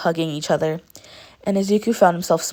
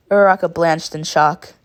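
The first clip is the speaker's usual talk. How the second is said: ordinary delivery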